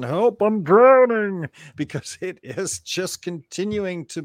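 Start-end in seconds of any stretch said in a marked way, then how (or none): 0.0-1.5 high, loud, slow cry for help; cheesy drowning cry. Acted. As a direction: ham up a drowning person calling help. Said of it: "I should do some kind of cheesy imitation".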